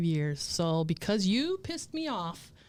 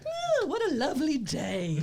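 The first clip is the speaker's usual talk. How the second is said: high, gleeful singsong with laughter around it; mock-innocent cheer; 7 semitones higher than usual. Camper the morning after secret revenge, mock-innocent cheer. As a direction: play sweetly carefree, pitched way up.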